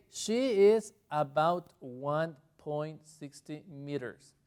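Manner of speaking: slow, measured English demonstration; slow model sentence. Shown as a demonstration. Teacher translating her example for students, slow and deliberate.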